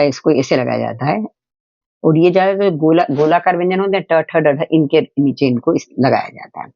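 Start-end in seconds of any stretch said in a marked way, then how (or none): none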